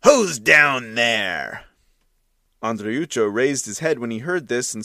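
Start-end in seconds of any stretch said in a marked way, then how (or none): 0.0-1.6 slow, louder rough challenge; menacing pimp at the window; fierce bark. A narrator's reading. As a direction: growl it down like a thug. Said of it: "barked at him fiercely".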